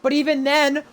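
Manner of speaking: high, loud, slow emphatic call; motorsport commentator. Real, not acted. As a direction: stress the podium news. Live race play-by-play.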